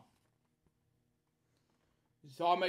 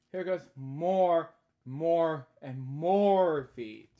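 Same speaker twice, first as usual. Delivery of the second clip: slow, louder drawn-out more; building read-aloud; 3 semitones higher, 8 dB louder, much slower than usual. Acted. Reading the climax of the foot book, slow and loud. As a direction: stretch each more out, growing.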